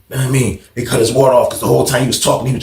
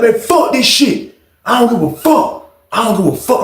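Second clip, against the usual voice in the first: acted blacking out; 8 semitones higher than usual. Enraged inmate answering guards, defiant acted anger. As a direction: imitate someone past caring, pitched up. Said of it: "Aaron is blacking out like this".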